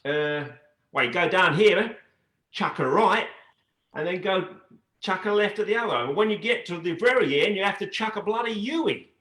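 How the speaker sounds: high, lilting Australian accent. Shown as a demonstration. Dancer showing off accents by giving directions, in an Australian accent. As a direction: give swinging, high-pitched Aussie directions. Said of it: "you had that Australian accent".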